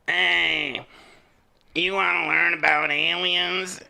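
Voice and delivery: mock smoker impression. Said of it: "like a smoker kind of voice".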